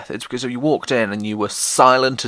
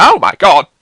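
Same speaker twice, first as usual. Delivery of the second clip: loud, high gasp of shock; startled exclamation; 8 semitones higher, 12 dB louder than usual. Retold or imitated. Boy nearly hit by a rounders ball, loud surprised cry. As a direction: yelp as the ball whizzes past. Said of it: "I went".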